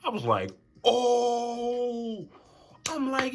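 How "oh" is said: high, loud, drawn-out "oh"; high mock-scandalized holler; shocked outburst. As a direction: cry it out in exaggerated shock. Acted outraged reaction to gossip.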